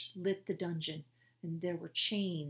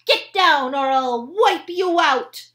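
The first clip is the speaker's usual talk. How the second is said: much higher, louder menacing shout; fierce threat; 10 semitones higher, 18 dB louder, slower than usual. Acted. Annie threatening the guards with her magic wand. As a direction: scare them off with your threat. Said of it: "Annie's fierce".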